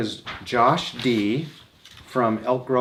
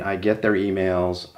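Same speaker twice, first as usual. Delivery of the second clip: ordinary talk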